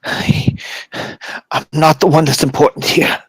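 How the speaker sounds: soft, halting, breaking off; failing magistrate. Acted. Weakened magistrate answering his would-be rescuer, soft and halting. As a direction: struggle to get each phrase out.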